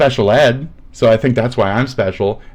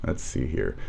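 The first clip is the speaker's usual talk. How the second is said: ordinary delivery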